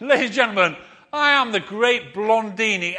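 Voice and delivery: booming ringmaster intro, laughing; loud, laughing big-top announcement; showman announcer. Pretend. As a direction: announce yourself like a circus showman. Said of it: "See how it goes".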